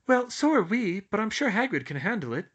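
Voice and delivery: high, unsure reassurance; uncertain schoolgirl. A narrator's reading. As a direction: reassure without believing it. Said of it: "if uncertainly".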